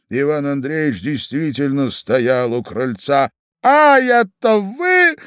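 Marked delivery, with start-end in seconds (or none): 3.7-5.2 loud, drawn-out squeal of recognition; high-pitched shout. A narrator's reading. Husband in fur coat discovers the lady, shouting high. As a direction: cry out shrilly, stretching the words. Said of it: "закричал господин в енотовой шубе".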